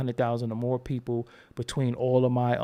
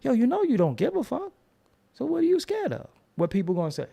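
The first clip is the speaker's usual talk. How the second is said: pumped up; 8 semitones higher than usual. Retold or imitated. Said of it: "and I say".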